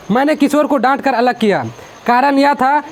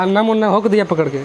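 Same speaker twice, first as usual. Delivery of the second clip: ordinary talk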